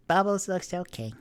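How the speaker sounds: put-on bright little girl's answer; child voice. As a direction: chirp it like a small kid. Child reporting to mother.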